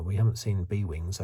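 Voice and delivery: usual speaking voice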